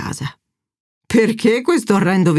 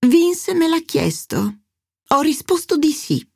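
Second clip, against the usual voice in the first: a lilting, provocative higher mother; defiant mother; 8 semitones higher than usual. Acted. Mother Angela answers her ex.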